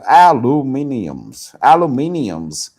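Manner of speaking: slow aluminiums, each syllable stretched; drawn-out aluminium. Shown as a demonstration. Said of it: "aluminum or aluminium".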